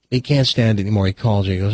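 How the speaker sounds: ordinary talk, nothing marked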